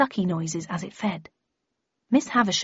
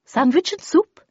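lightly voiced old lady of the story, higher and louder; higher-pitched character voice, lightly put on; Miss Havisham; 8 semitones higher, 6 dB louder than usual. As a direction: offer it in a raised, ringing voice.

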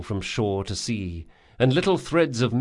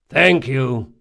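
louder shout to the door; yelled; 3 semitones higher, 6 dB louder than usual. Acted. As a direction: yell it across the room. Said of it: "yelled Jean".

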